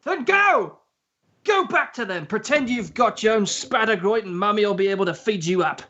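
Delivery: high angry retort; angry Harry dismissing Ron. Acted. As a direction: fling it at him. Harry throws him out.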